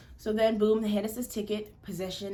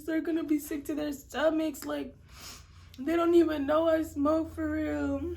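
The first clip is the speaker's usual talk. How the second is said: staged tearful wail, much higher; fake crying; 8 semitones higher, 5 dB louder than usual. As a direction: play up the tears to the officer.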